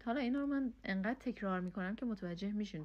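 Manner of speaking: usual speaking voice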